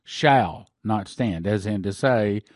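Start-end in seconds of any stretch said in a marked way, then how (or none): 0.0-1.5 "shall not stand" slow, each word weighted; a slow, heavy "shall not stand"; emphasis on shall not stand. Shown as a demonstration. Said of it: "with the emphasis on the word".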